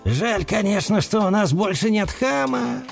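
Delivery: blissful. Acted. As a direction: sigh it out happily. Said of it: "блаженно откликнулся Мэтт".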